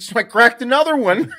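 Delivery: high, very loud imitation of dad; hammed-up caffeinated confession through amusement; jacked up. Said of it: "like, a little jacked up".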